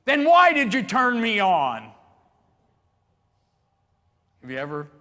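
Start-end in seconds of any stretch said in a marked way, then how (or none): 0.0-1.9 loud, high exasperated retort; GPS snapping back. Acted. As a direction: play the navigation voice yelling back at its driver. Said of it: "I expect that voice to say back to me".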